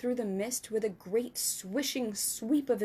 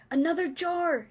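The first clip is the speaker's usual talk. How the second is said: higher, louder call; excited cry; 7 semitones higher, 5 dB louder than usual. Acted. Giant calling Sophie for another jar. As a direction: call out eagerly. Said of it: "he cried".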